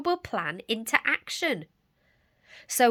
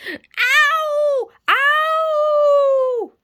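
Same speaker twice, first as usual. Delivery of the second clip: high, loud yowling ow; wolf howl of pain; 11 dB louder than usual. Acted. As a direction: howl like a hurt nose. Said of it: "howled the wolf".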